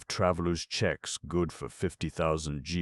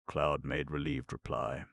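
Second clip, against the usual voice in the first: ordinary delivery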